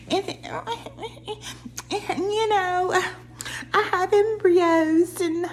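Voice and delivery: high, drawn-out mocking baby talk. Mocking a castmate's way of talking, baby voice. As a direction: imitate a grown woman talking like a baby. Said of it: "speaking in a baby voice".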